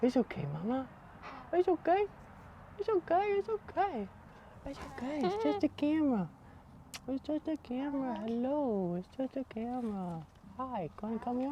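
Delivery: very high, soft soothing coo. Real, not acted. Calming frightened birds about the camera, soft high baby talk. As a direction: hush a frightened pet tenderly.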